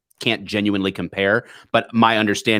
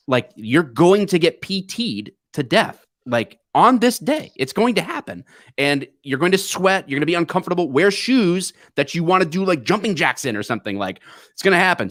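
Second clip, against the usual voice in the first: raised pitch, emphatic retold warning; insistent warning; 7 semitones higher than usual. Cops warning a friend before academy orientation, insistent. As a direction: press the point until he believes it.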